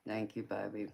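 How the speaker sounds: ordinary talk, nothing marked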